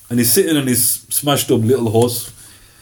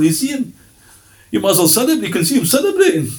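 higher, gleeful mock with a laugh; mock celebrating people; 8 semitones higher, faster than usual. People relieved the tyrant is dead, gleeful laughing mockery.

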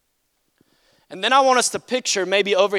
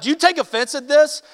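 tough challenge, higher and louder; UFC fighter Jesus; 8 semitones higher, 7 dB louder than usual. Retold or imitated. Jesus confronting grumbling disciples, tough fighter challenge. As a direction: throw it down like a fighter squaring up. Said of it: "this is U F C fighter Jesus".

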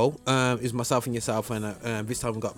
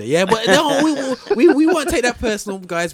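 much higher and louder, laughing through the words; 13 semitones higher, 8 dB louder than usual. Real, not acted. Host laughs off the jab that he shouldn't present. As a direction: squeal it out between laughs.

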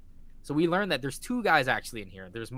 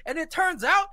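very high, loud and thrilled; hyped shout; 9 dB louder than usual. Real, not acted. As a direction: yell the twist like a fan. Hyped fan reacting to a twist.

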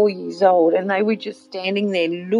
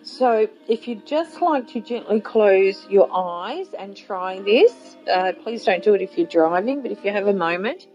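guided meditation. Acted. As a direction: gently lead them inward. Leads a guided visualization.

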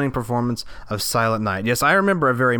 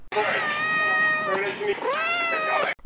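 long exasperated sigh; 5 dB quieter than usual. Acted. Long exasperated sigh mid-interview.

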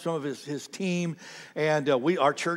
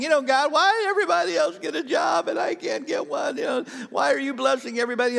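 much higher, fast whiny complaint; mock whining; 9 semitones higher than usual. Pastor imitating a friend whining to God about a job. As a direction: whine to God like a jealous kid. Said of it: "I'm whining".

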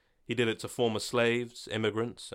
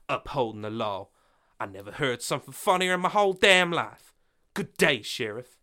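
scornful mockery; 4 semitones higher, 5 dB louder than usual. Laura mocks the sheriff.

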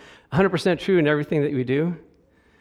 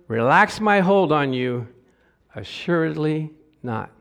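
slow, weighty reading with force; scripture read with great weight; solemn scripture reading; 4 semitones higher, 4 dB louder than usual. Acted. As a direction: lay each promise down slowly. Reads scripture slowly, emphatic.

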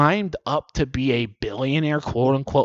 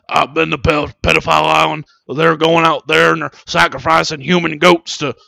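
Alex Jones impression; 7 dB louder than usual. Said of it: "Alex Jones would be like".

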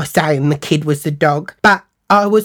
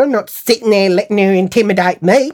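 usual speaking voice

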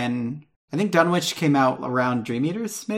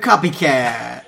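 drawn-out, slightly louder mock accusation; mock taunt; 5 dB louder, much slower than usual. Retelling how others accused them, mock taunt. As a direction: stretch it out like a teasing jeer.

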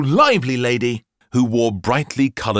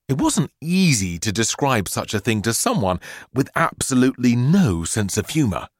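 usual speaking voice

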